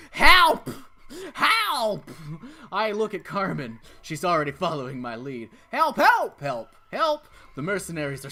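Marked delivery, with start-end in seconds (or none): 0.1-2.1 loud, high desperate yells; screaming for help. Acted. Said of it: "I scream at the top of my lungs". 5.7-7.3 loud high repeated cries; yelling for help. Acted.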